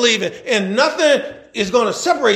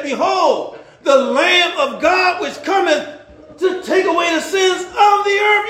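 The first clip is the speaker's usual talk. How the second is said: proclaiming; 6 semitones higher, slower than usual. Acted. Preacher quoting John the Baptist.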